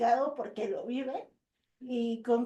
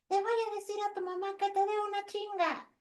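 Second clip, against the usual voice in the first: higher, childlike mock threat; child voice; 9 semitones higher, 3 dB quieter than usual. Boy threatening the friend, high childlike voice. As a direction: play the bratty little boy, pitched up. Boy's threat quoted to daughter.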